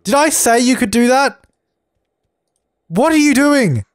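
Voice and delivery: real anger at the game character; angry scolding. Real, not acted. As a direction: tell them off sharply. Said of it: "I apologize for getting angry".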